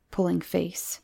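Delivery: ordinary delivery, nothing marked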